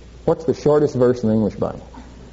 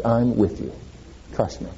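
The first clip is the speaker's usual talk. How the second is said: soft, lower comforting words; God comforting a person in pain, soft and tender; tender reassurance; 5 semitones lower, 7 dB quieter than usual. Acted. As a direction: quietly reassure someone who is hurting.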